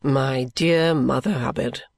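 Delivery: slow, low character voice; almost grave Richard. A narrator's reading. Richard answering a hint about his carelessness, almost grave. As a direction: go low and slow, nearly serious. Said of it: "This made him almost grave".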